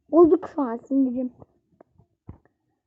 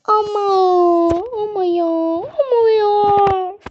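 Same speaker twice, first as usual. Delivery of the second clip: high, slow, near-crying protest; whimpering whine; 5 semitones higher, 4 dB louder, slower than usual. Real, not acted. Gamer whining to viewers after losing, near tears.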